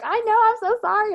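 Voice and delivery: high, fast, hammed-up apology; apologetic client. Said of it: "They're like".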